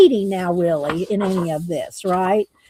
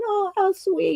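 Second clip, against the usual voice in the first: higher little mouse, touched; sweet mouse voice; 10 semitones higher than usual. Acted. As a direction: coo it like a tiny mouse at the reunion. Mouse moved at reunion.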